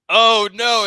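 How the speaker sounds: dismayed. Retold or imitated. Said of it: "I said".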